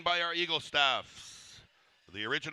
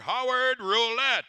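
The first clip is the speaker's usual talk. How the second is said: louder, higher name introduction; announcer call-out; 9 semitones higher, 8 dB louder than usual. Real, not acted. Announcer calls out dancer's name.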